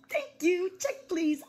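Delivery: high, jokey customer voice; a hint of a fussy diner; mock diner. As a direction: ask for the check in a silly voice. Joking restaurant customer bit.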